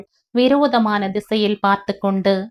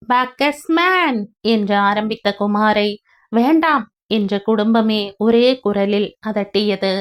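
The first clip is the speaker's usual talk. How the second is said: ordinary delivery, nothing marked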